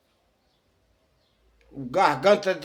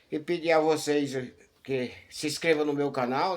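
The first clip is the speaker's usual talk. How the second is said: ordinary talk, nothing marked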